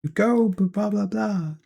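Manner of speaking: lightly voiced pushy friend telling him to rest; imitating Mal. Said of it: "She would have been shitting on me, like".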